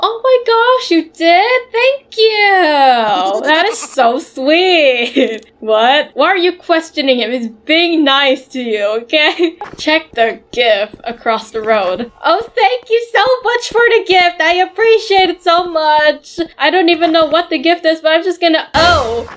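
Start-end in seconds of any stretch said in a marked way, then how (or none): 0.0-5.4 gushing thanks, pitched up high; high excited gush. Real, not acted. Gushing over the gift. 12.2-16.4 gushing thanks, voice up high; overdone high gratitude. Real, not acted.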